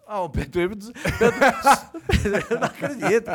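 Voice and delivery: high, loud laughing disbelief. Real, not acted. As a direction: laugh it out, can't believe it.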